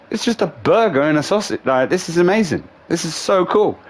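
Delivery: playing a kid thrilled by a discovery; giddy delight. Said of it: "I'm like".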